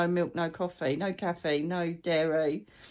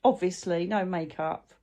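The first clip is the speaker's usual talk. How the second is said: ordinary delivery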